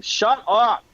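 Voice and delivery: loud, high yell at the dog; shouting at dog. Real, not acted. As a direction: snap loudly to silence the dog. Yelling at whining dog.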